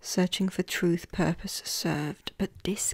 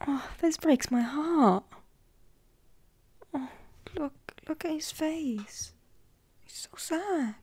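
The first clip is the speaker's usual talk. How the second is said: pitying; 7 semitones higher, 3 dB quieter than usual. Real, not acted. Moved by sad crow card.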